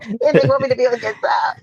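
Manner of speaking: laughing through the words; giggling as she teases him; cracking up mid-sentence. Real, not acted. Laughing while teasing Ken.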